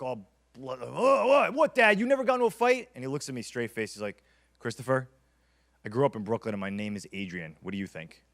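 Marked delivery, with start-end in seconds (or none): 0.0-2.9 rising higher and louder in disbelief; mock shock. Son to dad after learning dad never fought, shocked disbelief. 4.6-8.2 a calm father settling the matter; deadpan dad. Retold or imitated. Father telling his son about Brooklyn, dry and lowered.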